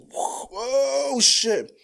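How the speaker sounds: high shocked gasp then loud. Retold or imitated. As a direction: blurt it out in shock. Crashing into parked car.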